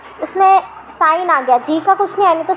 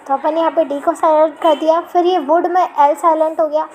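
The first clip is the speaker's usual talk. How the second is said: normal talking voice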